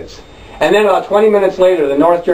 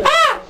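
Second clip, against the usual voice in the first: mock startled yelp. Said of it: "this bottle crashes near her".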